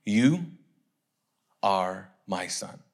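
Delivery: low, slow, solemn voice of God; God's voice. Acted. God speaking to Jesus from heaven, solemn low voice. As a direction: intone it slowly and low, with long pauses. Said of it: "what did the voice say".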